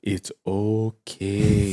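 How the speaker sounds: very slow, drawn-out reassurance; Wim Hof. Acted. Calming viewers mid breath hold, very slow. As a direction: soothe like a breathing guru, slowly. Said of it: "that's the Wim Hof breathing".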